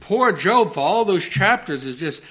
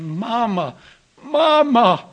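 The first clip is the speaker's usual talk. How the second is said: second "Mama" much higher and louder, a wounded cry; crying for mother; 6 semitones higher, 4 dB louder, slower than usual. Retold or imitated. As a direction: call for mama like a dying soldier. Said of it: "crying out for their mother".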